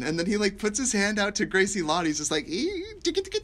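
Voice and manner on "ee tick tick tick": very high, creepy mock squeal; creepy villain noises. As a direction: imitate a villain's creepy high-pitched ticking. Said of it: "there's the creepiest moment when".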